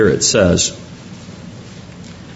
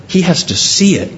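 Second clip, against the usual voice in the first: ordinary talk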